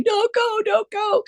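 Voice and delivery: crying plea. Retold or imitated. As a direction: echo the daughter begging through tears. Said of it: "she's just crying".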